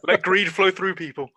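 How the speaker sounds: laughing. Real, not acted. Player jokingly invoking a streamer.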